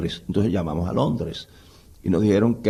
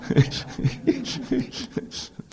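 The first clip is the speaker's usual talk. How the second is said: high breathy laugh; 4 dB quieter than usual. Real, not acted. Laughing at people who draw promise cards.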